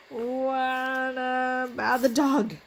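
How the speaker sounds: ordinary talk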